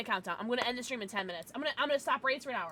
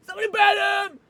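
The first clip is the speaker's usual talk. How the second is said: laughing shout; 9 semitones higher, 13 dB louder, faster than usual. Real, not acted. Laughing plea to mods.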